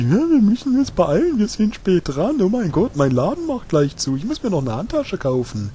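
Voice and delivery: high, silly mock girly voice; mocking ditzy girl. Gamer mocking the companion's chatter in a high girly voice. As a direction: ham up an airheaded girl in a hurry. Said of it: "irgendwas loslässt wie".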